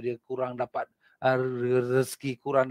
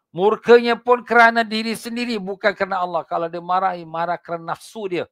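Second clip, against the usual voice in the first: fiery preaching; 7 semitones higher, 8 dB louder than usual. Acted. Preacher warning the congregation about hypocrites, fiery. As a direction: drive the warning home from the pulpit.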